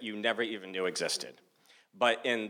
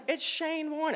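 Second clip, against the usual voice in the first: very high-pitched, incredulous; exasperated insistence; slower than usual. Retold or imitated. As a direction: insist on the obvious, voice shot up high. Exasperated friends insist on the name.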